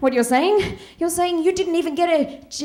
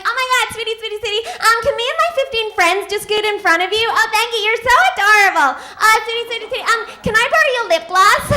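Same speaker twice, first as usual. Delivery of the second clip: higher-pitched gushing bathroom flattery; super-sweet LA girl; 5 semitones higher, faster than usual. Acted. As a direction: play the sugary club girl asking favors. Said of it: "super sweet".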